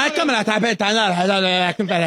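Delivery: fast garbled impression of a co-host; mocking imitation. Host mimicking the gossip reporter's talk, mocking imitation. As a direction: rattle into nonsense "ra, ra", teasing. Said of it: "la gente va a pensar que sí hablo así".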